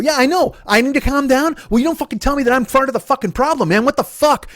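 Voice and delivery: high, worked-up retort; angry outburst. Retold or imitated. Answering brother who said calm down, angry. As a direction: refuse to calm down, pitch way up. Retold quarrel, told to calm down.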